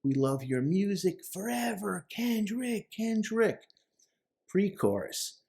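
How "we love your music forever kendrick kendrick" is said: high-pitched mock fan praise; adoring fans. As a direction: imitate fans calling out to their idol. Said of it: "what is he hearing?".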